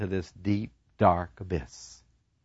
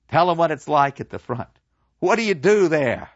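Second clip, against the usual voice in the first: louder, prompting professor; eager professor; 5 semitones higher, 5 dB louder than usual. Acted. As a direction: coax him to speak up. Professor prompts before class.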